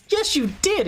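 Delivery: high, indignant comeback; retold insistence. Streamer retelling his squeaky indignant yes-you-did to the viewer. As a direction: snap back a childish yes-you-did.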